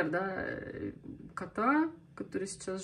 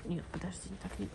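usual speaking voice

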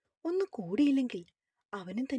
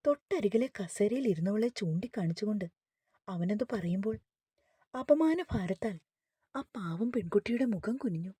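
ordinary delivery

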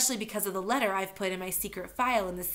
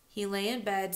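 usual speaking voice

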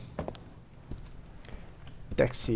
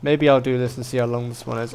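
ordinary delivery

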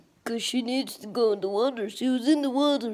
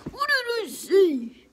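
mock scolding; 9 semitones higher, 5 dB louder than usual. Dino Dad scolds the lion.

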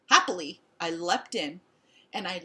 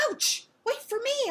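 high, pleading holler; 3 dB louder than usual. A narrator's reading. Dog calling after owner's speeding car, hollering. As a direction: holler after someone leaving you behind. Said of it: "I hollered".

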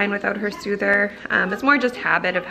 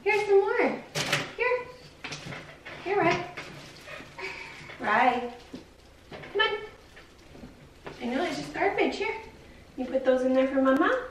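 put-on sing-song baby talk, pitched higher; talking to her toddler; 5 semitones higher than usual. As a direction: coax a little girl gently, like a mom.

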